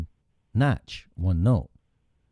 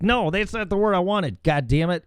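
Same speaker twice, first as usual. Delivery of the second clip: mock frustration at phone; 9 semitones higher than usual. Cursing at phone autocorrect.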